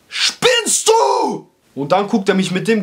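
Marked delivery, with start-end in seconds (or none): 0.1-1.5 shrieked high and sharp; shocked outraged cry. Retold or imitated. Slapped by the barber, a shrill outraged shriek. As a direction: yelp it in disbelief.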